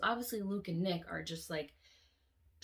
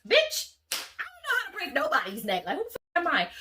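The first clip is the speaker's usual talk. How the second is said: much higher, louder, mock-outraged aside; incredulous outburst; 11 semitones higher, 8 dB louder than usual. Real, not acted. Admitting to viewers she bluffed, high and loud. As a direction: crack up at your own bluff. Laughing at her own bluff.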